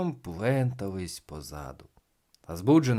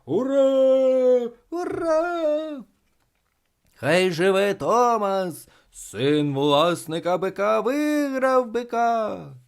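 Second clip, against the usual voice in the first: loud, high, drawn-out shouts; cheering crowd; 11 semitones higher, 7 dB louder, much slower than usual. A narrator's reading. Spectators hailing Thomas as winner, loud jubilant cheering. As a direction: hail the winner at full voice. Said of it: "закричали глядачі".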